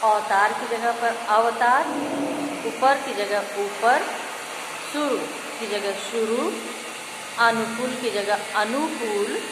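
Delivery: loud, stretched word pairs; emphatic loud drill. Shown as a demonstration. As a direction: call each word out to the class.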